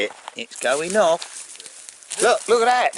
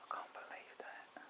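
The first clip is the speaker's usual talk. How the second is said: slow, awed whisper; 26 dB quieter than usual. Real, not acted. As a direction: breathe it out in disbelief.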